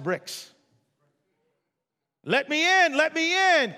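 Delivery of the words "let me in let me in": high, loud wolf call; wolf crying out. Acted. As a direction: call out like a hungry wolf at the door. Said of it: "cried the wolf".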